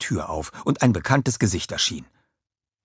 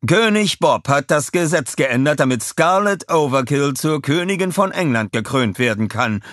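higher, projected news report; reporter voice; 7 semitones higher, 5 dB louder than usual. A narrator's reading. Reporter covering the law change, raised reporter voice. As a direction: announce it formally to camera. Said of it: "sagte er in die Kamera".

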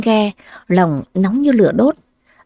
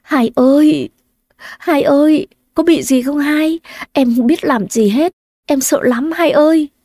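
put-on higher, crying little-girl voice; tearful child; 5 semitones higher than usual. Little sister weeps over her rescued sister, tearful. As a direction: sob out her fear to her sister. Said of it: "Bé Thảo cũng nước mắt, nước mũi tèm lem".

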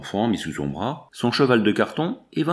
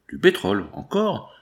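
a grocer's startled double-take; surprised repeat; 8 semitones higher than usual. Acted. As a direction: echo her order back, amazed at encore.

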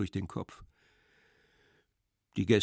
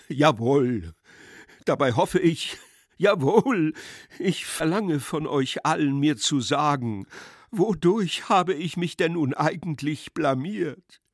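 raised, halting, agitated plea; near tears; 4 semitones higher, 5 dB louder than usual. A narrator's reading. His Excellency begs all guests for judgement, near tears. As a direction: plead in a higher, louder voice. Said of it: "in Tränen auszubrechen".

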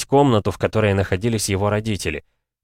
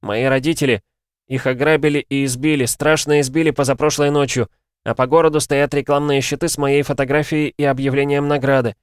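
put-on higher-pitched, mildly distressed boy; urgent plea; 6 semitones higher, 3 dB louder than usual. A narrator's reading. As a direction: plead for help down the phone. Lost boy pleads on the phone. (взволнованная мольба мальчика)

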